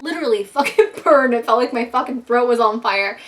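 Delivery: ordinary talk, nothing marked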